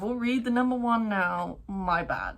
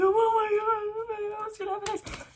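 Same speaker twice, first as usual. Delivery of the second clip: hooked excitement; 14 semitones higher than usual. Retold or imitated. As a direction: drag out the suspense, pitched way up. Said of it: "I'm like".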